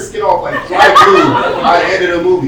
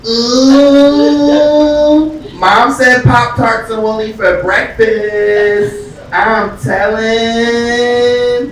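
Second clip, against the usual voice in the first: high, loud, slow singsong tattle; little sister singsonging that she's telling mom; tattling little sister; 5 semitones higher, 7 dB louder, much slower than usual. Retold or imitated. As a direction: sing it out like a bratty little sister. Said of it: "The next thing I hear is".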